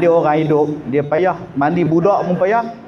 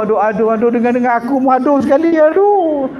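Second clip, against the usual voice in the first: mock groans; 6 semitones higher, faster than usual. Man mocking the old man's groans, a string of pained aduh. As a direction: groan over and over.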